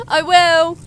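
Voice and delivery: high, loud call to another room; calling back. Acted. Streamer promises housemate to report weasels, calling back.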